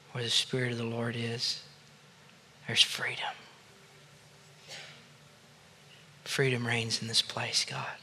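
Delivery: reverent murmur to God; hushed prayer. Real, not acted. Hushed closing prayer after guided response.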